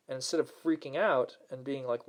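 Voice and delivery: normal talking voice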